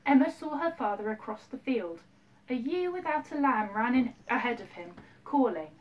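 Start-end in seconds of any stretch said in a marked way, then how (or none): none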